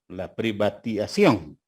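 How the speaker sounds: usual speaking voice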